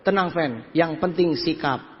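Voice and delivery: low, slow and measured; steady your partner with slow assurance; calm reassurance. Retold or imitated. Speaker reassuring partner Effendi after a failed meeting, low and calm. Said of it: "saya sama Effendi bilang apa".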